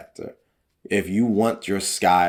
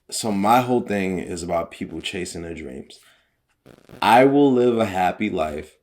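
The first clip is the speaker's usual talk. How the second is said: ordinary delivery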